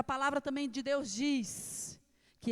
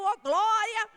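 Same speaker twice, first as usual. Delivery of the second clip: much louder joyful shout of praise; 8 semitones higher, 11 dB louder, much slower than usual. Retold or imitated. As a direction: shout the glória out, higher. Praising while the fire burns. (grito de louvor bem alto)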